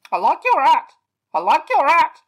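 high, loud squawked cry; squawky bird cry. A narrator's reading. Unseen toucan calling out to Eric, loud squawk. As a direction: shout it like a parrot. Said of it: "cried the voice".

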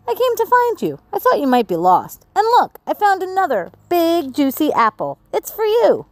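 Molly's voice. Acted. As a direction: bring the apple to your friend brightly, pitched high. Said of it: "said Molly".

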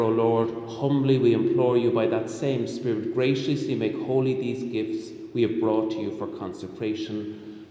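normal talking voice

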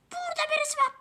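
high, loud alarm; startled shout. Real, not acted. Spotting an enemy in the bush, a sudden high yelp.

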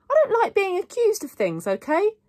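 high, loud, offended retort; indignant. A narrator's reading. Girl objecting to being accused, indignant.

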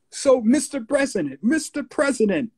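high-pitched mock interview question; Gary Bird's high voice. Radio host puts a question to the president, high showman voice. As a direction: imitate a high-voiced DJ quizzing someone. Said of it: "he had this high voice".

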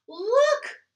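high, very loud exclamation; cry out. Acted. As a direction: call out in surprise. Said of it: "cried Bear".

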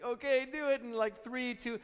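put-on high, loud little-boy brave voice; imitating son. Dad imitating his nervous son before the tooth pull, high and loud. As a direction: play the five-year-old bracing himself. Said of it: "he's like".

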